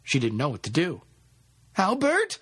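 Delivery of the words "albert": very high, rising scream of a name; shrieked call, held back in volume; panicked scream. A narrator's reading. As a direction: scream for him in alarm. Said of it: "she screamed".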